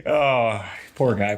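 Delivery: ordinary talk, nothing marked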